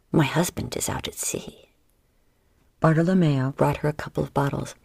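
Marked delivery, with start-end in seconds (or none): 0.1-1.7 whisper. Acted. Flirting, hinting her husband's away.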